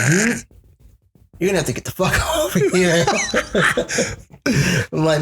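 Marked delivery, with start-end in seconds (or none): 0.0-3.0 hammed-up, higher-pitched plea breaking into laughter; theatrical retelling. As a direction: overplay the retold line, laughing midway. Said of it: "I made that really fucking theatrical".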